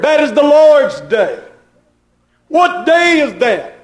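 high, insistent preaching cadence; emphatic preaching. Real, not acted. Preacher telling believers they are in the Lord's day, high emphatic preaching. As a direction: press the point home to the congregation.

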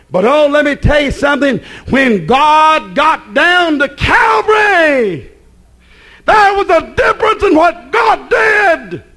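rising, slow, shouted preaching; preaching at full force. Acted.

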